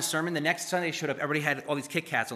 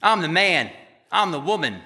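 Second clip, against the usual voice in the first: cocky boast; 6 dB louder than usual. Retold or imitated. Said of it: "I know you don't literally talk like that".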